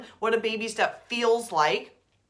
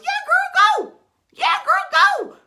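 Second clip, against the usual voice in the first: high, loud squealing cheer; cheering God voice; 10 dB louder than usual. Pretend. God cheering you on in a high loud shriek. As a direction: cheer like a hyped best friend. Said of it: "I believe God comes in and goes".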